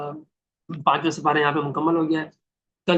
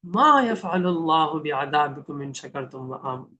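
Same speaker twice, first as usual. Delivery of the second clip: slow, lower, loving recitation; tender recitation; 4 semitones lower, much slower than usual. Shown as a demonstration. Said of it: "بڑے پیار سے اللہ کہہ رہے ہیں". (نرم، دھیمی اور پیار بھری تلاوت)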